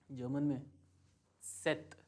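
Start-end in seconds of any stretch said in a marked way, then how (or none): 1.4-2.0 सेत with a half T run into the S at the start; German Z letter name. Shown as a demonstration. Teacher naming the letter Z in German, crisp ts onset. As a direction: say सेत as "tset". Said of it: "आधा त और से और त".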